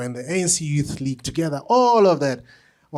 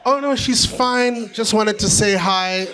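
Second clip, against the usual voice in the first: nervous backing off; 7 semitones higher, 4 dB louder than usual. Retold or imitated. Flustered young man to Mandela, high and nervous. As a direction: squeak out an excuse and try to slip away.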